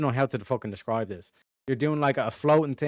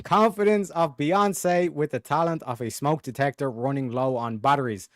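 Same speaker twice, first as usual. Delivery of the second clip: normal talking voice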